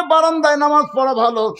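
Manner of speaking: high-pitched calling complaint; crying out. Acted. Shepherd protesting to the weeping congregation, crying out. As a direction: call it out high over the weeping. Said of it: "রাখাল চিল্লা বলে".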